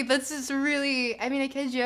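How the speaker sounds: ordinary talk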